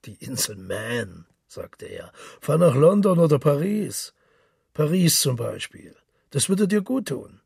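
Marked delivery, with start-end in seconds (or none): 0.0-1.3 slow, lower scoffing repeat; mocking echo. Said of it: "Ignatius Gallagher lachte". 2.4-7.4 loud, high, jovial journalist back home; hearty boastful Gallagher. Acted. Gallagher brags about Paris.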